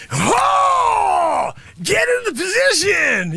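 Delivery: loud, high drill-instructor yell; drill shout. Acted. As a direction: yell the command at the class. Sensei barking at sloppy students.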